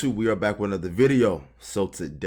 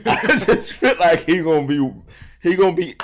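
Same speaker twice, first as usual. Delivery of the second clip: laughing; 9 semitones higher, 9 dB louder than usual. Real, not acted. Laughing prediction about the guest.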